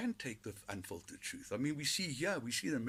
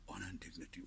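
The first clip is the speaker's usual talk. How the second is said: whispered honor and dignity; hushed, sarcastic reverence; mock whisper; 3 semitones lower, 9 dB quieter, faster than usual. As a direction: breathe it like a sacred secret. Mocking politicians' claims.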